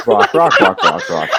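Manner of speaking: chanting through laughter; giggly repeated words; laughing. Real, not acted.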